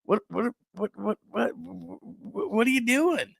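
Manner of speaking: high, halting, sputtered disbelief; mock bewilderment. Host sitting among chanting fans, acted baffled sputtering. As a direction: stammer softly, then squeak out the question. Acting out his baffled reaction.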